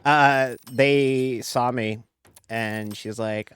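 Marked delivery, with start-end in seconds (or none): none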